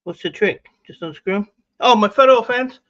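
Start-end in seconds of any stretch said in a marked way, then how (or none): 1.8-2.8 surprised exclamation. Real, not acted. Reading a comment, exclaiming.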